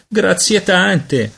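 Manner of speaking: high, very drawn-out mock gratitude; sarcastic thanks. A narrator's reading. Peter retorts, disgusted by her answer.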